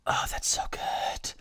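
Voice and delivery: slow whispered relish; savouring whisper. Pretend. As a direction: whisper it like a first sip of coffee. Savouring the coffee.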